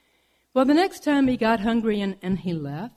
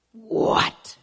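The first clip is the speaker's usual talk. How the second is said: startled bobcat; 4 dB louder than usual. Acted. Bobcat alarmed by rival.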